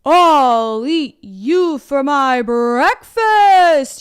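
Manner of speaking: slow, loud troll growl of a threat; yelling troll. A narrator's reading. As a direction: bellow it slowly like a hungry troll. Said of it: "yelled the troll".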